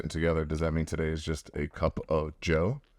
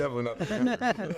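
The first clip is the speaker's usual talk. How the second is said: laughing; 11 semitones higher than usual. Real, not acted. Teasing about rival team.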